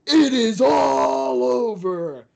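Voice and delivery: high, loud, slow drawn-out call; UFC commentator's catchphrase. Retold or imitated. Imitating a commentator when the fight ended, loud stretched shout. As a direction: stretch each word like a fight announcer. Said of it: "he always would say".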